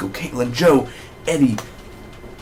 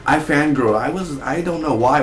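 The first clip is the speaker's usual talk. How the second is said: ordinary delivery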